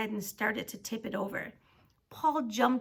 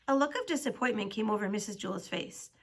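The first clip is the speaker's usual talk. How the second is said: ordinary delivery, nothing marked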